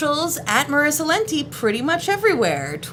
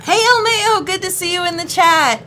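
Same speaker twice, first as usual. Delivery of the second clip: bright greeting; 8 semitones higher, 5 dB louder than usual. Real, not acted. Greeting a viewer in chat.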